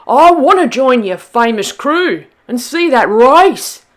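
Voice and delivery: a Tasmanian devil demanding to join the crew; roared. Acted. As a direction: roar it like a wild devil. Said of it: "he roared".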